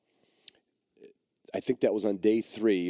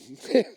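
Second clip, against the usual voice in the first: real laugh; 7 semitones higher, 6 dB louder than usual. Real, not acted. Laughs recalling the moment.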